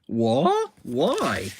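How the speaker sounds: high-pitched acted bafflement; mock dismayed questioning; shocked disbelief. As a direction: squeak the first word up high, as if stunned. Stunned reaction to popped balloon.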